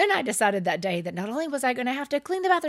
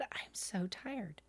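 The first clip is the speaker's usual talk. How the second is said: slow, voiceless whisper, drained; exhausted whisper; 16 dB quieter, much slower than usual. Retold or imitated. Worn-out woman whispering to her partner in the car. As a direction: let it sink out slowly in a whisper.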